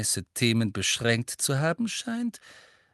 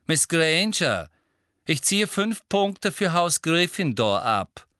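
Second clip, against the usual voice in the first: slow, loud, higher pronouncement; stern punishment; 7 semitones higher, 5 dB louder, slower than usual. A narrator's reading. Teacher docks house points before the class, stern and loud. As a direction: announce the penalty with weighty authority.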